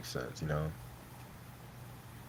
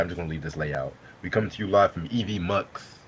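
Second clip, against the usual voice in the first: usual speaking voice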